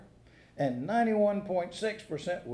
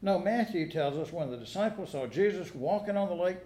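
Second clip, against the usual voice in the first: usual speaking voice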